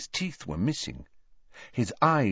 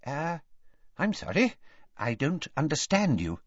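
hesitant, higher-pitched apology; timid official, pitched up; nervous; 6 semitones higher, 4 dB quieter than usual. A narrator's reading. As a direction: falter politely, unsure of yourself. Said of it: "said Ogden nervously".

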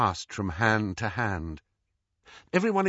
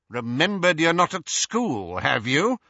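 lightly voiced Minister, higher, needling; menacing Minister; 6 semitones higher than usual. Minister confronts Harry face to face, menacing. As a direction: rebuke him with a touch of menace.

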